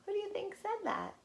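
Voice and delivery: ordinary delivery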